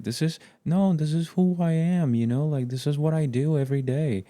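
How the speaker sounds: high, insistent defensive voice. Retold or imitated. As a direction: protest like it's your identity. Voicing addicted person's defence.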